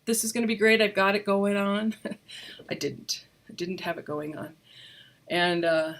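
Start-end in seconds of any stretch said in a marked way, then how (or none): none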